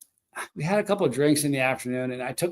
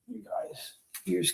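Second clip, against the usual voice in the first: whispering; 4 semitones higher, 10 dB quieter, slower than usual. Real, not acted. Dog asleep nearby, hushed.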